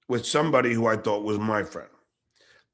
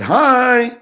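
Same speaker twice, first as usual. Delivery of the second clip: high, loud bright greeting; chirpy hi; 10 semitones higher, 7 dB louder than usual. Retold or imitated. Coworker sticking her head in the door, chirpy. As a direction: pop the word Hi up high and cheery.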